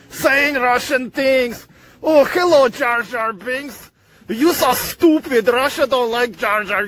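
high, hammed-up Russian wrestler boasting, drawn-out words; Russian accent. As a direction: bellow with mock Russian pride and big laughs. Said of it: "say he's not Russian".

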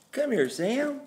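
sing-song call to the puppy; high dog-calling voice. Real, not acted.

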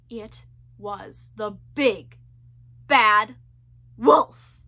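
slow build then loud on bad wolf; suspenseful, rising to a loud reveal; dramatic reveal. A narrator's reading. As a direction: hold back, then burst out with the name.

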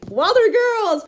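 high, loud burst of joy; excited cry. Real, not acted. Showing viewers a long-wanted book, loud and excited. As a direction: call out the title, thrilled.

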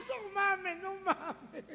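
strained, high-pitched wailing; panicked whine. Acted. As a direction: squeak it out in comic distress. Same man whimpering after the scream.

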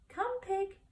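high, sing-song call to a pig; coaxing farmer. A narrator's reading. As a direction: coax the pig gently toward the pail. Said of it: "said Mister Zuckerman".

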